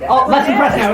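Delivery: ordinary talk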